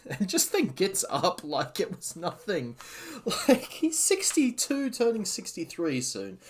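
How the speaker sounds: high-pitched, incredulous, laughing; real laughter pushing the voice up high; laughing disbelief. Real, not acted. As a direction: laugh through it in amazed disbelief.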